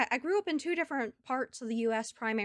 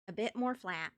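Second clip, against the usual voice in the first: neutral American accent; 5 dB quieter than usual. Shown as a demonstration. Streamer demonstrates accent to viewers, neutral American accent. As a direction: flatter neutral American accent, Southern dropped. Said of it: "my normal accent sounds a bit more like this".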